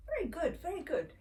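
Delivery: happy praise. Retold or imitated. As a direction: praise the little one brightly. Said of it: "made a soft sucking and I said".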